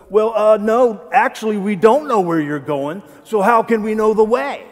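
doubting Thomas talking back with sarcasm; sarcastic attitude. Acted. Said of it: "There's a little bit of an attitude in that".

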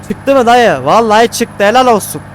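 loud happy cheer; excited relief. Real, not acted. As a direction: cheer the truck on. Truck finally climbs out. (kamyon çıkınca sevinçle bağırma)

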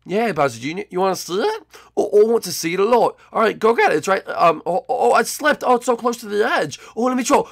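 normal talking voice

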